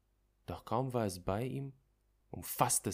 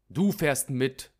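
scharfer Befehl; 6 semitones higher, 12 dB louder, slower than usual. Acted. Doctor orders the groom.

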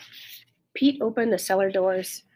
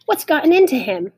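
higher, louder exasperated call; mother crying out; 7 semitones higher, 6 dB louder than usual. A narrator's reading. Mother asks about the digging dog, crying out. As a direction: cry the question out from indoors. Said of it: "my mother cried from the kitchen window".

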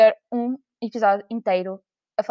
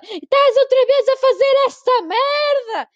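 higher, louder, slower scolding; imitating grandma scolding; 7 dB louder, slower than usual. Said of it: "e ela". (avó a ralhar, aguda e alta)

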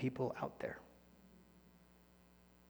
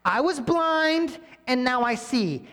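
much higher, louder, slow and drawn out; proclaiming testimony; 9 semitones higher, 8 dB louder, slower than usual. Retold or imitated. Healed man declaring to the Pharisees, triumphant. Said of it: "And he's like".

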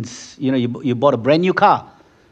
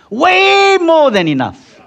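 "Way" cried out much higher and louder; preaching peak; 6 dB louder, slower than usual. Acted. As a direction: soar up on "Way" with full force.

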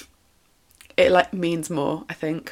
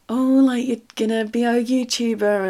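higher-pitched mimicking of critics; lightly mock the naysayers in a raised voice; mock judgmental; 5 semitones higher than usual. Imitating people who look down on YouTubers, mock judgmental. Voicing the stigma around YouTubers.

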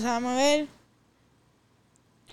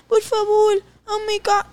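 mocking imitation; 6 semitones higher, much slower than usual. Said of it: "te va".

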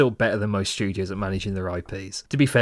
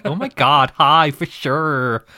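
mock California Valley Girl, drawn out slowly; Valley Girl accent; 4 semitones higher, 6 dB louder, much slower than usual. Imitating how Brits do American, slow Valley Girl accent. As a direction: gush it like a Valley Girl from Clueless. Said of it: "California Valley Girl accent".

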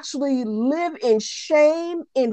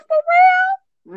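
squealing; 5 dB louder than usual. Real, not acted. Emphatic repeat, pitch jump.

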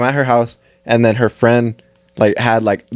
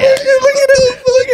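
mocking friend; 4 dB louder, slower than usual. Homies filming and mocking.

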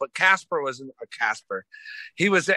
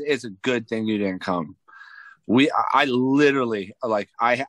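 normal talking voice

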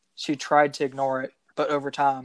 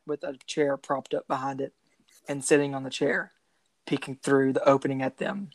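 ordinary talk

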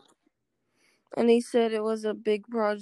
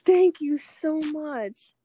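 higher, slow, swooning; gushing thanks; 5 semitones higher, 5 dB louder, much slower than usual. Acted. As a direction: gush like she's flattered. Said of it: "we're gonna role play".

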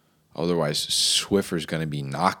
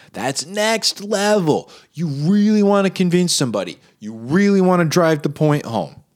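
exaggerated enthusiasm; 7 semitones higher, 5 dB louder than usual. Exaggerated praise of marketing.

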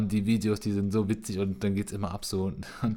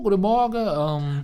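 much higher, louder overly sweet cop greeting; super nice policeman; 11 semitones higher, 7 dB louder, slower than usual. Retold or imitated. Carwash worker imitates polite police arriving, sugary sweet. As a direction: greet like an extremely friendly police officer. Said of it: "die sind immer krank nett".

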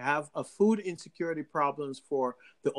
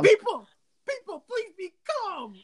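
high-pitched mock plea for calm; pleading store workers. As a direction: imitate exasperated workers begging a crowd. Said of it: "the poor people who work there".

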